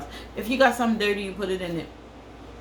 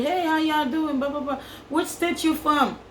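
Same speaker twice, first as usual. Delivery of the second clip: mock friendly visitor; 7 semitones higher than usual. Mocking visitors' fake small talk.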